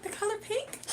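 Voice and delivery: high, bewildered echo of the question; mock puzzled repeat. Repeating a stranger's question in disbelief. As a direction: echo it back as if it makes no sense. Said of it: "gonna be like".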